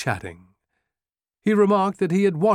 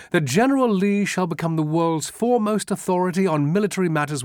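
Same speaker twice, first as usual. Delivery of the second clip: higher, brisk English officer; an eager, admiring Englishman; a visiting English officer praising Lee; British officer; 7 semitones higher, faster than usual. A narrator's reading.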